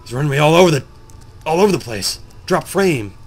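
lightly voiced grumbling game character; a hint of a put-upon creature complaining; imitated weary Cthulhu. Cthulhu grumbling about the player, lightly voiced weary complaint. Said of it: "It's just like".

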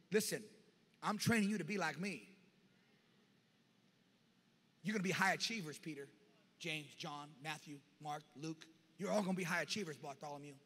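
soft, low and unhurried counsel; Jesus calm teaching. Retold or imitated. Jesus quietly reassuring his disciples after the storm, calm and soft. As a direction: reassure the frightened disciples gently. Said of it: "the Lord looked at him and said".

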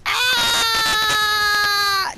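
long loud high scream; drawn-out shout; high yelling scream. Real, not acted. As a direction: scream as you go down. Death scream in game.

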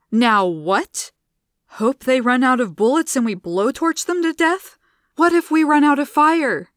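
loud, rising panicked bellow. A narrator's reading. Gianna bellowing at the group, panicked. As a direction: bellow it in desperation. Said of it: "Gianna bellowed".

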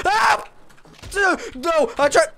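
high yelling panic; startled yelp. Real, not acted. Gamer losing track of the monsters, startled yell. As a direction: yelp as he falls. Falling from the elevator.

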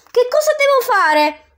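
high, loud urgent ask; eager question. Acted. Dante eager to follow Virgil, high urgent question. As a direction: ask at once, keen. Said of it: "domandai subito".

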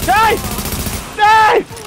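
high call across. Real, not acted. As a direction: call him from far off. Calling out to teammate.

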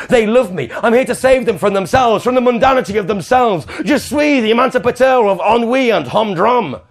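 soar into delusions of grandeur; rising manic grandeur; grandiose rapture. Acted. Queen's grandiose self-hype building.

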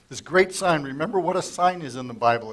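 ordinary talk

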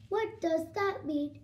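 evenly spaced robot question. Acted. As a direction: ask it like an uncomprehending machine. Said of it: "I am a robot".

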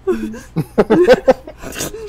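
hiccuping laugh; gasping hiccup laughter; hiccup giggles. Real, not acted. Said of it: "laugh hiccuping things".

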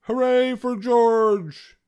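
high, loud, drawn-out cheer; slow, ringing hooray from the crowd; cheering cry. A narrator's reading. Players lifting up their hero, cheering. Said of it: "they cried".

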